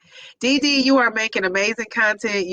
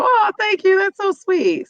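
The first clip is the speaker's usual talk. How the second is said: touched thanks; 10 semitones higher than usual. Real, not acted. Touched by a viewer's compliment.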